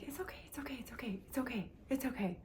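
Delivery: breathy hushed whisper, repeating gently; calm yourself down under your breath; whispered self-soothing. Retold or imitated. Calming her pounding heart at a café table, whispered.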